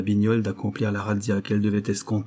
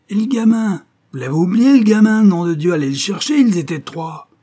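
high, loud outraged yelling; shrieking concierge; 10 semitones higher, 9 dB louder than usual. A narrator's reading. Concierge yelling at the police about the boy, high and loud. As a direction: shriek after the police about the missing kid. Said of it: "La concierge surgit hors de sa loge, gesticulant".

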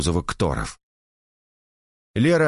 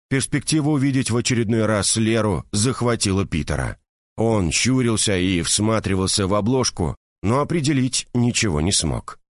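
ordinary delivery

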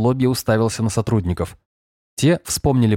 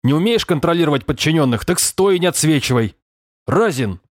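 higher-pitched irate boss, not shouting; angry reprimand; 6 semitones higher than usual. A narrator's reading. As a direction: scold a subordinate sharply. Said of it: "взревел подполковник". (сердитый начальственный разнос)

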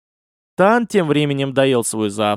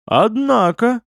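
mildly surprised, pitched up high; a touch of raised-pitch amazement; 8 semitones higher, slower than usual. A narrator's reading. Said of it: "удивился я". (слегка удивлённо)

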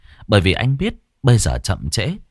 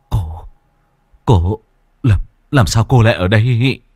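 louder, halting frightened stammer; stammering in fear; 5 dB louder than usual. Acted. Hùng stammering at the ghost, afraid. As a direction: stumble over the words in fear. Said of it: "miệng lắp bắp".

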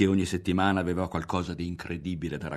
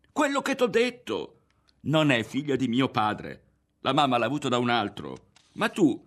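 high, raised, agitated voice; worked-up boy arguing back; heated insistence; 6 semitones higher, 4 dB louder than usual. A narrator's reading. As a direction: insist hotly against disbelief. Berto insists in quarrel.

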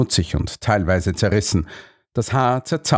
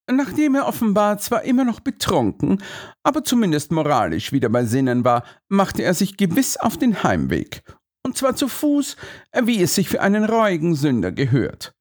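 higher, lively detective voice; Holmes character voice; 9 semitones higher than usual. Acted. Holmes explains the count's route, confident detective voice. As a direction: Holmes reasoning aloud with confidence.

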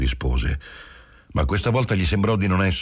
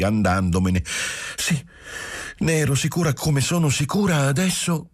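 put-on higher young woman's voice, rushing her confession; Giulia, young wife, anxious; 12 semitones higher than usual. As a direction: pour it out, half tearful, half relieved. Giulia insists, very high. (giovane moglie che confessa, voce alta e agitata)

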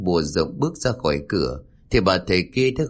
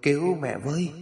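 softer, strained cry for rescue; hoarse, broken plea; 4 semitones higher, 5 dB quieter than usual. A narrator's reading. Old mother begging her daughter to save her from demons, hoarse plea. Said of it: "cất giọng khào khào đứt quãng".

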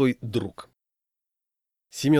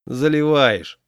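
louder, higher dismissal; dismissive; 4 semitones higher, 6 dB louder, much slower than usual. A narrator's reading. Friend doubting the wedding news, dismissive. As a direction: wave the news away as a tall tale. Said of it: "отмахнулся Разгоняев".

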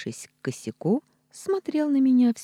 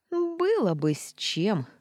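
grumbling cat; 6 semitones higher, slower than usual. Acted. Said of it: "проворчал следующий за рыцарем кот".

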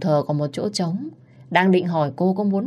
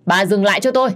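shouted higher and much louder; 4 semitones higher, 6 dB louder, faster than usual. Acted. Mother shouts at mother-in-law to stop hitting her daughter. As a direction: yell to stop her now.

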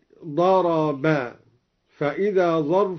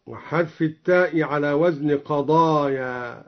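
ordinary talk

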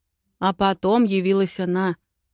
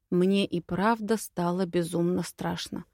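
ordinary delivery, nothing marked

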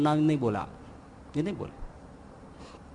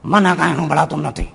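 put-on old woman's excuse; 6 dB louder than usual. Old woman feigns deafness.

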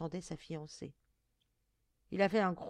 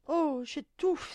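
slow, high, loud gasped plea; suffocating cry; 7 semitones higher, 6 dB louder, much slower than usual. A narrator's reading. Girl crushed under the monster, stifled high cry. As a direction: cry out as if being smothered. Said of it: "s'écria-t-elle".

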